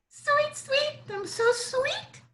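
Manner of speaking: very high, sweet little bird voice; sweet warbler song. A narrator's reading. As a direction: chirp it sweetly like a tiny warbler. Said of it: "sings sweetly".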